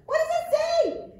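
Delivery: high, loud excited puppet voice, partly sung. Acted. Puppet Arturo greeting the children, high excited voice. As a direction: bounce in as an eager little monkey puppet.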